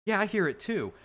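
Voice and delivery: friend agreeing. Retold or imitated. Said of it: "he goes".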